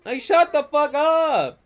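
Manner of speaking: loud, high shout of annoyance; angry outburst. Real, not acted. Vlogger yells at TV actors' bad Spanish, loud and high. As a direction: yell it in pure frustration.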